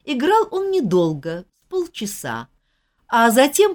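usual speaking voice

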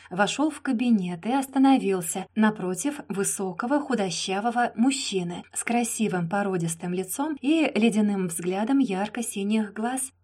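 ordinary delivery

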